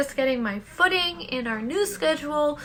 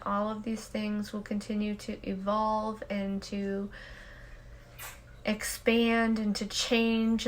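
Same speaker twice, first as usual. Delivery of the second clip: guided meditation; 4 semitones lower, 6 dB quieter than usual. Acted. Host leading listeners in the daily peace break, soft meditation voice. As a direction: guide them into stillness, unhurried and hushed.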